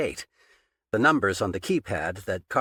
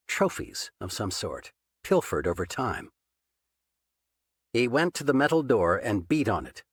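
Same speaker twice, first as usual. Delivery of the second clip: ordinary delivery, nothing marked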